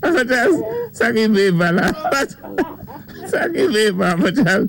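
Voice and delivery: high-pitched talk through laughter; can barely speak for laughing; host cracking up after the joke, laughing through words. Real, not acted.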